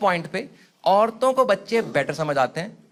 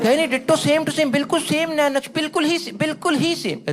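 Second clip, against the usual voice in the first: fawning parent; 5 semitones higher than usual. Retold or imitated. Parent fawning over aunt.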